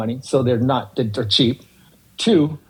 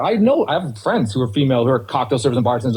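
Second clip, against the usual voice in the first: usual speaking voice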